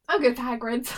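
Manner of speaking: loud retold quote, laughing before it; quoting him. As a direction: imitate him announcing his choice loudly. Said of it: "He was like".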